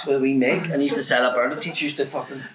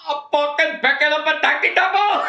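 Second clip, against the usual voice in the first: exasperated teacher; 4 dB louder than usual. Retold or imitated. Teacher's answer acted, exasperated.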